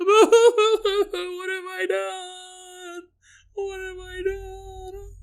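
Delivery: high, very slow wailing cry; hammed-up sobbing plea; sobbing woman in the stall, wailing; mock crying. Woman sobbing again, acted.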